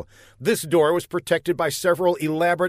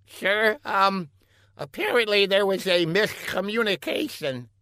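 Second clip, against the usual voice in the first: high-pitched, halting lackey voice; hesitant scientist underling; 7 semitones higher than usual. A narrator's reading. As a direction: break bad news to the boss gingerly.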